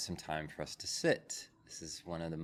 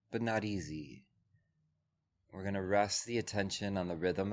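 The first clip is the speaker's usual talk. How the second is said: calm, measured voice with long pauses; guided meditation. Real, not acted. Teacher settles a group into breath and body, guided meditation.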